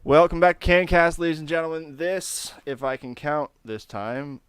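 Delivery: loud hype show-host intro; podcast announcer. Acted. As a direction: boom it out like a host welcoming fans.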